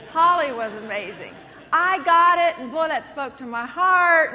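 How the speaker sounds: mock gush of a moved churchgoer. Enthused churchgoer, imitated.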